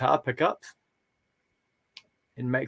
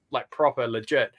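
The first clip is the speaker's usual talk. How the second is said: normal talking voice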